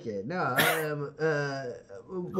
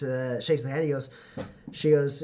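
ordinary talk, nothing marked

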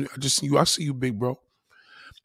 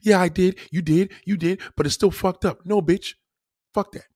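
hammed-up quarrel; 7 semitones higher, 4 dB louder than usual. Acted couple quarrel.

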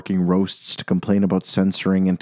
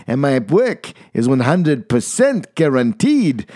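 clear keen salesman; 6 semitones higher than usual. Acted. Salesman pitching his fix to coiners, keen. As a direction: sell it like a huckster. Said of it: "in a voice clear and keen".

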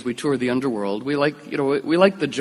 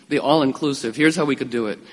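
ordinary talk, nothing marked

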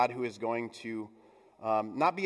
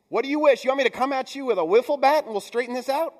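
higher, louder, fast swaggering challenge; Paul's voice; 9 semitones higher, 7 dB louder, faster than usual. Retold or imitated. Paul daring the proud church to come at him, loud and cocky. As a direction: throw down the gauntlet like a cocky brawler. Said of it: "This Paul's kind of voice popping through".

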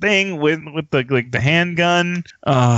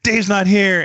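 high-pitched, eager quote; retold starstruck blurt; blurted catchphrase; 8 semitones higher, slower than usual. Retold catchphrase shouted at Cheech.

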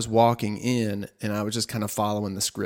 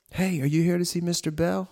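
lady greeting; 7 semitones higher than usual. Retold or imitated. Lady greets him in hallway.

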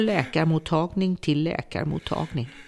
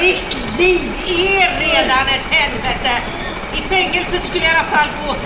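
captor shouting back at her prisoner; bitter shout; 8 semitones higher, 6 dB louder than usual. Acted.

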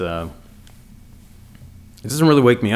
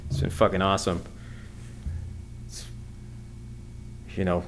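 ordinary talk, nothing marked

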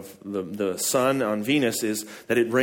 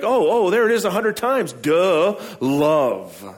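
higher, louder mock realization; mock duh; 6 semitones higher, 5 dB louder, slower than usual. Pastor imitating a reader finding the key word, mock duh. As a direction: play the obvious discovery. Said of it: "and you're like".

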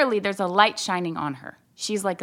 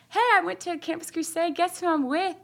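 higher-pitched, teasing reveal; excited news; 9 semitones higher than usual. Retold or imitated. Calling her sister with a surprise, excited news. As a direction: share big news, keep them guessing.

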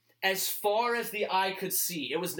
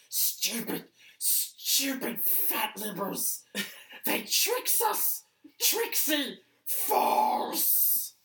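raspy whispered Gollum hiss; Smeagol voice; 5 semitones higher, much slower than usual. Retold or imitated. McConnell sleep-talking in bed, whispered Gollum hiss. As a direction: hiss it like a bitter cave creature. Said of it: "He has to sound like Smeagol".

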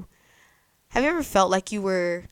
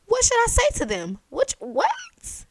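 anxious Moses; 5 dB louder than usual. Acted. Moses questioning God anxiously.

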